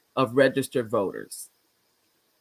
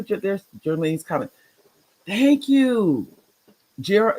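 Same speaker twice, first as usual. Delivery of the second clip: ordinary talk, nothing marked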